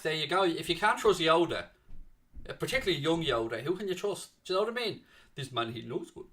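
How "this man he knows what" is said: low, quiet mock Jamaican accent. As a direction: slip into a lightly done Jamaican accent. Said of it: "why am I doing Jamaican accents".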